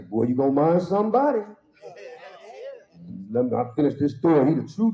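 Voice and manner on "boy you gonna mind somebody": lightly voiced scolding grandma, a touch higher; a hint of a stern grandmother warning; grandma warning her grandson, lightly scolding. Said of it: "my grandma say".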